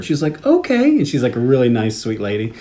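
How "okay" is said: high-pitched, hesitant okay, imitating a friend; wary okay. A nice lady answering a movie suggestion, wary. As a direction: mimic an unsure reply, pitched way up.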